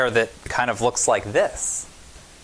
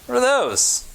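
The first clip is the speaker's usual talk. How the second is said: put-on curious child; 13 semitones higher, 3 dB louder than usual. Child asks in story.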